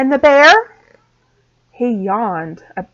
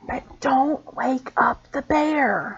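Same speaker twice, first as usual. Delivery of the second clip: whispering; 3 semitones higher, 6 dB quieter, slower than usual. A narrator's reading. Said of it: "whispered the hare".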